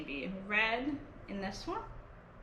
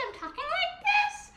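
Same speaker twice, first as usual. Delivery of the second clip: very high pitched demo voice; really high pitch; 4 dB louder than usual. Shown as a demonstration. Music teacher showing children a high pitch, very high voice. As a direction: jump way up high to show the kids a high pitch. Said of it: "if I have a really high pitch".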